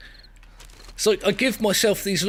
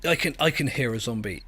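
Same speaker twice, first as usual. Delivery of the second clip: normal talking voice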